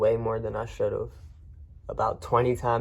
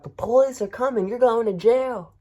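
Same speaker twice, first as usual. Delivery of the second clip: much higher, louder retold yell; mock threatening shout; 11 semitones higher, 5 dB louder than usual. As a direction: warn him off like an angry homeowner.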